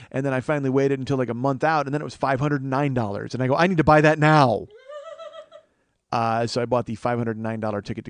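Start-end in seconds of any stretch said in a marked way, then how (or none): 3.5-4.7 urgent outburst. Acted. Acted panicked decision to buy.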